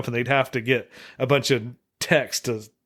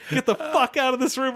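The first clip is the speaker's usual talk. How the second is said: much higher hammed-up yell at the bishop; mock angry order; 11 semitones higher than usual. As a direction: throw him out, hammed up. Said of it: "It says".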